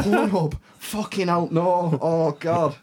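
high, loud exclamations of horror; mock horror. Real, not acted. Electrician reliving the client's porn tabs, high-pitched horror. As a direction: cringe out loud at the memory. Reacting to embarrassing memory.

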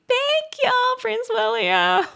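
very high, drawn-out cutesy voice; sugary squeal, slow and high; playful gushing thanks. Pretend. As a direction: sing out your thanks like an adoring fan. Mock-gushing thanks to the prince.

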